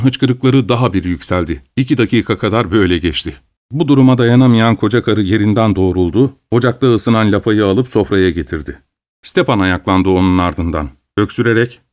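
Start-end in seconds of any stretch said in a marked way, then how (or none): none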